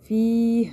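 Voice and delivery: فی held as a long level "fee"; elongated vowel. Shown as a demonstration. Teacher shows stopping on it, فی drawn out. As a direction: stretch فی and let a clear h close it. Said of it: "لمبا کھینچ سکتے ہیں".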